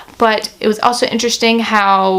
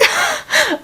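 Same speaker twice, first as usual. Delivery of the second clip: laugh; 4 dB quieter than usual. Real, not acted.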